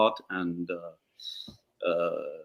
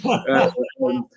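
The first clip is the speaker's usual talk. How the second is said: a high, real burst of laughter; giggling at his own line; 12 semitones higher, 3 dB louder than usual. Real, not acted. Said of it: "laughs after quote".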